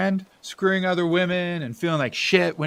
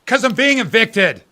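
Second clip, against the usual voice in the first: sudden loud shout, pitched up; angry outburst; 6 semitones higher, 6 dB louder than usual. Acted. Bill shouting at Richie that he's being evicted, angry. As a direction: blurt it out in fury at his brother. Brothers' quarrel, eviction revealed.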